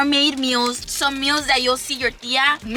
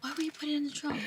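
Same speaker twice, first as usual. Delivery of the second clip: whisper; 5 semitones higher, 13 dB quieter, much faster than usual. Real, not acted. Secretive whisper about surprise.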